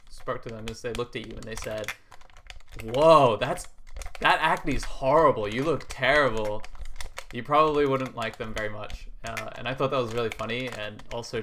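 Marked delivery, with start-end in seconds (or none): 2.8-6.6 loud, slow, blunt shock at acne; blunt loud exclaim. Pretend. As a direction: blurt it out like a tactless friend. Imagined rude friend blurting insults.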